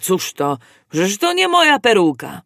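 a judge's indignant cry of surprise; startled outcry. A narrator's reading. Judge finds he has the wrong wig on, startled outcry. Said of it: "zawołał".

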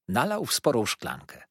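usual speaking voice

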